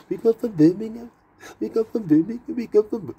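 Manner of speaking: imitating a Birmingham accent. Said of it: "I love Birmingham accent".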